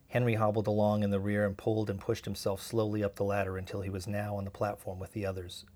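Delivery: usual speaking voice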